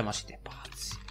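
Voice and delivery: breathless whispered disbelief. Acted. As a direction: whisper it to yourself. Aside after listing effects.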